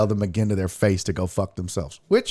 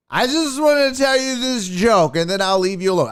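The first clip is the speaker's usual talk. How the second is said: dopey heckler; 11 semitones higher than usual. Retold or imitated. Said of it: "I said".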